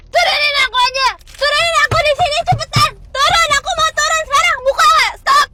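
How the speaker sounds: high, very loud demands; worked-up, high-pitched yelling; furious shouting. Acted. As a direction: shout to be let out right now. Quarrel, demands to be let out.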